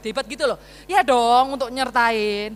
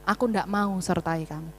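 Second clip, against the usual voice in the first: ordinary talk, nothing marked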